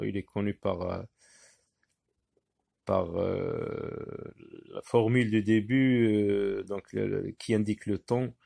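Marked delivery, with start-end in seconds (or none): none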